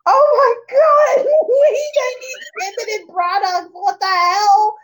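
hammed-up horrified shriek; mock distraught outcry. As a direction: cry out in exaggerated disbelief, half laughing. Acting out reaction to watching Lost.

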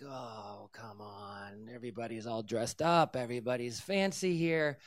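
low, quiet, weary protest; reluctant groan. Retold or imitated. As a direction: plead under your breath, reluctantly. Retold reluctant grumble to God.

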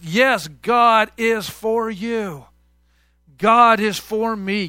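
slow, drawn-out affirmation; slow declaration. Acted. Preacher assuring the congregation, slow and deliberate. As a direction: lean on each word and let it land.

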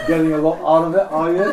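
loud, fast mocking jab, laughing; sarcastic Satan. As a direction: needle her with loud scorn. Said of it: "Satan comes and says".

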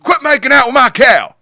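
high, loud angry farmer yelling. Acted. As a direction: shout at the cow kissers like a furious farmer.

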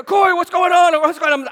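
high, loud rambling panic; frantic call. Retold or imitated. Pastor on the phone to Corey about the smoke, frantic. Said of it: "and I go".

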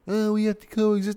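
high-pitched mimicry of other people; mocking quote. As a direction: parrot them in a high, scornful voice. Mocking people who defend killing.

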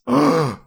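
sharp high gasp of mock outrage; shocked gasp. Real, not acted. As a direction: gasp like you've been insulted.

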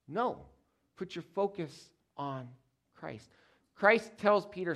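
ordinary delivery

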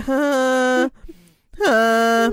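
loud, blank "huh" grunts; imitating zoned-out noises at the nail tech; mock vague noises. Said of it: "I was just making noises".

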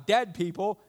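ordinary delivery

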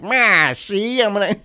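1930s gangster voice, "see"; mustache twirling villain. Retold or imitated. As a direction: growl it like an old-timey villain. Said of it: "nineteen thirties mustache twirling villain".

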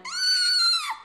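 high-pitched imitated koala shriek; koala scream. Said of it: "and it screamed".

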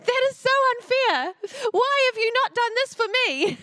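mock annoyed. Mother mock-complaining to her husband, whiny. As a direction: whine about the unfairness, half joking. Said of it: "And I was really annoyed".

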